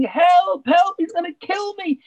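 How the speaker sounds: high, loud mock cry for help; retold scream for help. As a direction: yell it like he's about to be eaten. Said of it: "he started screaming".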